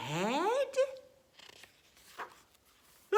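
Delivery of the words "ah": short sharp startled yelp; sudden alarmed "ah"; startled cry. Acted. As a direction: jump at the news with a yelp. Elephant shocked by bird.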